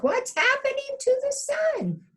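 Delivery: very high frightened question; alarmed pig. Acted. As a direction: pig squealing in panic.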